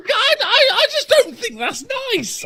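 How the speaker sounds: high, prissy mock outrage; pearl-clutching, laughing through it; mock prim disapproval. Mock-prim reaction continues.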